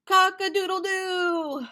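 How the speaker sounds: high crowing rooster call; playful rooster imitation. Shown as a demonstration. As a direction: crow it like a rooster. Teacher imitating rooster for kids.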